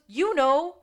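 a hammed-up emphatic Gus. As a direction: insist it is obvious. Gus quoted in recap.

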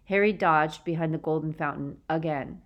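ordinary delivery

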